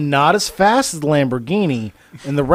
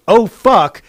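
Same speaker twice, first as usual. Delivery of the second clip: mock yell, higher and louder; retold yell; 6 semitones higher, 6 dB louder than usual. Coworker seeing the boxes fall, retold yell. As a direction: imitate a coworker's alarmed yell. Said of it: "Chris yells".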